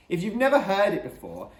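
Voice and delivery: ordinary delivery, nothing marked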